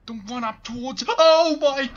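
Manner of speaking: high panicked shriek; startled scream. Real, not acted. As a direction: scream as it lunges at you. Monster rushes at player.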